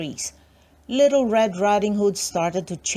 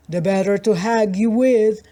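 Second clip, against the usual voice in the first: louder sly wolf; wolf in disguise answers the girl, louder; 5 dB louder than usual. Acted. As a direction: purr it like the wolf in the bed. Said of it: "said the wolf".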